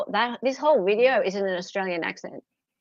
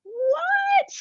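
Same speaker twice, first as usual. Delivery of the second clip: much higher, squeaky disbelieving what; incredulous what. Real, not acted. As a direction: shoot the word up in disbelief. Incredulous reaction.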